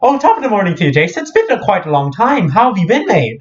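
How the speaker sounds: high, lively mock-British greeting; mock British accent. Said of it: "speaking all British and stuff".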